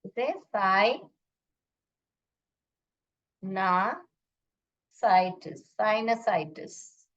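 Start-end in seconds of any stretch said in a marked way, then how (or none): 0.1-5.7 साइनासाइटिस stretched very slowly as "sai-na-sai-tis"; teacher slowly models साइनासाइटिस; slow pronunciation demo. Shown as a demonstration. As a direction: lay out साइनासाइटिस syllable by syllable, very slow. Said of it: "ऐसे pronounce करते हैं".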